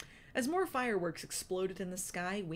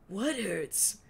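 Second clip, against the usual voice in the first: Wingtip's voice; 3 semitones higher, 4 dB louder than usual. A narrator's reading. Wingtip's held voice.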